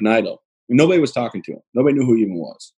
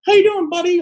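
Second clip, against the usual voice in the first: mock high-pitched, loud cooing; patronizing greeting; 5 dB louder than usual. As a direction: talk down to him like a little child. Mimics people patronizing him in wheelchair.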